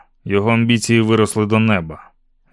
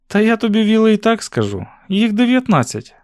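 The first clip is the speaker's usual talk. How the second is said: put-on higher-pitched girl's voice; young heroine, answering readily; 11 semitones higher than usual. A narrator's reading. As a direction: answer him as the girl, high and frank. Narrator voices Susan answering Will.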